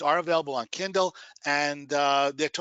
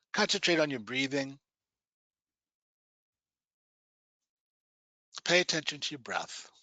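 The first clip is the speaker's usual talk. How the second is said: guided meditation. Acted. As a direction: guide them gently into relaxation.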